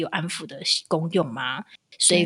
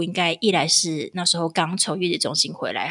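normal talking voice

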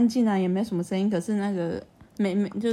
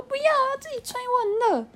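put-on much higher, insistent little kid; stubborn child voice. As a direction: refuse like a stubborn toddler. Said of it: "我说".

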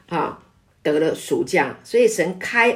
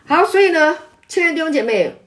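rousing call; 8 semitones higher, 3 dB louder, faster than usual. Real, not acted. Preacher rallies the congregation.

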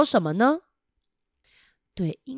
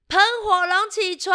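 calling out; 11 semitones higher than usual. Shown as a demonstration. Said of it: "再叫一次试试看哦".

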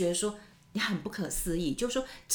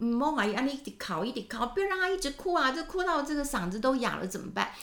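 nagging grandparent; 6 semitones higher than usual. Retold or imitated. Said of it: "就讲媳妇说".

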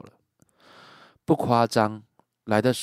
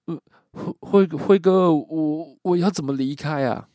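terrified stammer; 8 semitones higher, 6 dB louder than usual. Retold or imitated.

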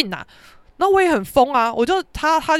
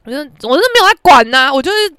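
ordinary talk

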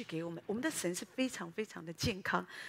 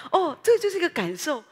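excited; 6 semitones higher, 9 dB louder than usual. Shown as a demonstration.

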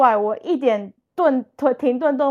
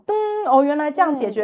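high-pitched aha moment; sudden realization; 5 semitones higher than usual. Acted. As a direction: act out an idea suddenly clicking into place. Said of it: "让你突然间有这种".

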